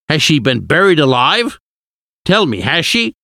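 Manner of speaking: agitated, pitch rising high on each question; angry questioning. A narrator's reading. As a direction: press urgently for the answer. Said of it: "Arthur asked angrily".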